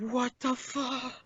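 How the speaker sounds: high, slow, drawn-out what the; baffled, dragging out the words; stunned disbelief. Real, not acted. Co-host hearing the twist, drawn-out high disbelief.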